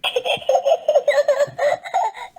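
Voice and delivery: squealing high laugh; high giggle. Acted. Peppa giggling at squeaky Daddy Pig, high laugh. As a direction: burst into giggles.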